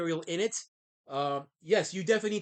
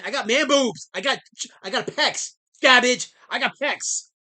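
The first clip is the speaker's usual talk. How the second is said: louder, higher mock protest; playful protest; 6 semitones higher, 8 dB louder than usual. Real, not acted. Streamer protesting the chat's joke about his chest, loudly. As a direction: push back at the teasing, half joking. Defending himself to chat.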